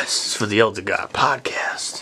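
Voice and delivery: hushed aside while others drink; whispering. Real, not acted. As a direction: keep it to a whisper. Whispering during the chug.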